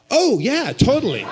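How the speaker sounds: loud, high, breezy agreement; blithe confirmation. Retold or imitated. Man tells worried friend it's haunted, blithe confirmation. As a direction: confirm it emphatically, as if obvious.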